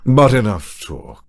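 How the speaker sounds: slow, deliberate Dracula read; slowed-down villain line. Shown as a demonstration. Critic demonstrating a better Dracula read, slowed down. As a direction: deliver it slowly, with weight. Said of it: "Slow down the line".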